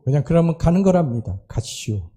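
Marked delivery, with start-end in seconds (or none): 1.5-2.1 a quieter, lower, slow Chungcheong "가시오"; soft slow Chungcheong drawl. Retold or imitated. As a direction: let it drift out slowly like a Chungcheong elder. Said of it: "충청도 사람은". (조용하고 느린 충청도 말투)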